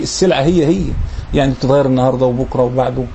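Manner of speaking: normal talking voice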